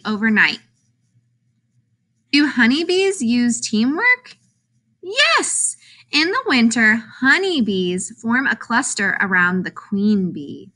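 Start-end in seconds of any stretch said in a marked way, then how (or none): 5.0-5.8 high, exclaimed yes; excited yes. Acted. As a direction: pop the yes up high with delight. Playful answer to silly question.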